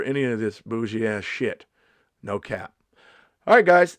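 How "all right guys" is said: loud. Real, not acted. Loud sign-off.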